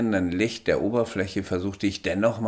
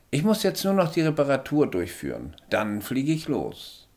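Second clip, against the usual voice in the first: higher little alien voice, matter-of-fact; small alien; 8 semitones higher than usual. A narrator's reading. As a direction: speak as the tiny alien, calm and sure.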